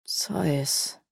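puzzled murmur of an unknown name. A narrator's reading. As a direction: mutter the strange name under the breath. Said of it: "murmelte Asuf".

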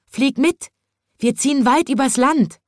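high twittering bird voices; chirping birds. A narrator's reading. Birds inviting the chimney sweep along, high chirping. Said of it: "zwitscherten die Vögel".

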